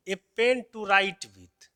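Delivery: the whole phrase read in one slow run, pen stressed; slow, loud teacher read-out. Shown as a demonstration. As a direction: read the phrase out slowly for the class. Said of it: "अब जैसे देखिए".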